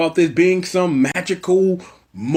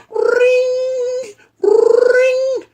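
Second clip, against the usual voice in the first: shrill high ring ring; high phone ring; 5 dB louder than usual. Shown as a demonstration. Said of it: "Let's try this one more time".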